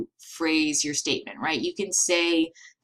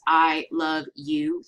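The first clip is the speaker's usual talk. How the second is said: "I love you" slow with a pause after each word; drawn-out "I love you" with gaps between the words; much slower than usual. Shown as a demonstration. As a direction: space out "I", "love", "you" to drive it home. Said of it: "pausing between the words".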